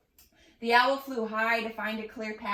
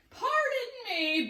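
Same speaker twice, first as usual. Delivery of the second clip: slow and much higher, sheepish; sniffling Bigfoot; 12 semitones higher, slower than usual. A narrator's reading. Bigfoot apologising for the sneeze, slow and sheepish. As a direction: excuse yourself after a sneeze. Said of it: "Bigfoot sniffled".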